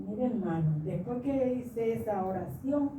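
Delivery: usual speaking voice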